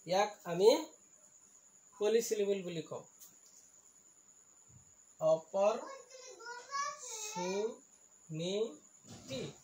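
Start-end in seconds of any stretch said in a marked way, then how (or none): 5.2-9.5 "opportunity" very slowly, split in pieces; syllable by syllable. Shown as a demonstration. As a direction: stretch each syllable of "opportunity" apart. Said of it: "ইয়াত চাৰিটা চিলেবুল আছে". ("Opportunity" ভাঙি ভাঙি লাহে লাহে)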